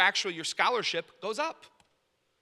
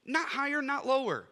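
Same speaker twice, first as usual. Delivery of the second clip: put-on to kids; 8 semitones higher than usual. Father teaching his kids about humility, higher voice. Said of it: "I tell my kids this".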